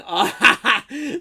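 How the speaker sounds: loud high burst of laughter. Real, not acted. Streamer hears chat had the same trouble, loud laugh. Chat shares his experience.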